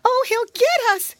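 very high, loud wail of alarm; alarmed lady cat, high and loud; frightened cry. A narrator's reading. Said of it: "cried the lady cat".

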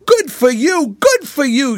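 high, hearty congratulatory holler; shouted cheer. A narrator's reading. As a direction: cheer them loudly across the water. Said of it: "shouted the go-ahead boys together".

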